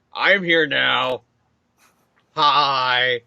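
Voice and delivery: lightly voiced villain, drawn out slowly; a slow, awkward entrance line; Doc Ock. Villain announces his arrival mid-fight, mildly drawn-out delivery.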